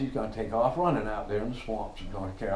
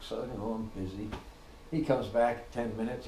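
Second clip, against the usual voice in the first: ordinary talk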